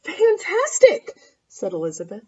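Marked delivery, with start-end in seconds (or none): 0.0-1.2 drawn-out high "Fantastic"; mock amazed. Elizabeth flatters the dragon, with mock amazement. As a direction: gush over it, stretching it out.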